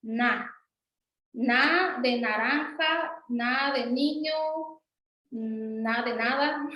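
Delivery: "na" said slowly in each word, clearly dental; "na" drawn out in naranja, niño, nada; slow teacher demo. Shown as a demonstration. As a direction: model the dental "na" slowly for the class. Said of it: "en suave nasal dental".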